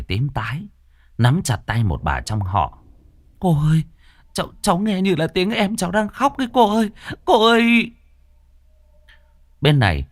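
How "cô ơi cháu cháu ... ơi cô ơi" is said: put-on much higher, trembling girl's voice; a scared sister clinging to her aunt; frightened pleading. A narrator's reading. Xuân, terrified, tells her aunt she hears her brother crying, much higher.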